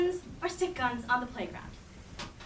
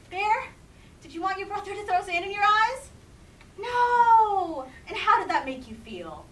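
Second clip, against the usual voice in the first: ordinary delivery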